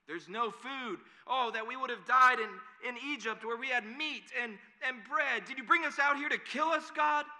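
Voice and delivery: loud, high wail of complaint; hammed-up grumbling. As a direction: shout it accusingly, as a grumbling mob. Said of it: "the people start grumbling".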